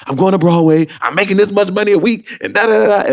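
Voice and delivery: excited shout about Broadway money; thrilled news. Retold or imitated. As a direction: announce it like the best news ever. Telling roommate the news.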